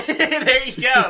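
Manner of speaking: laughing it out, slow and high. Real, not acted. Friend cracks him up, laughing agreement. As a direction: burst into laughter mid-phrase.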